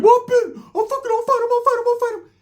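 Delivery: high squeaky hype. Retold or imitated. Super Norteño begging to fight, high squeaky hype. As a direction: squeal it like an eager little hype man. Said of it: "Super Norteño had to take".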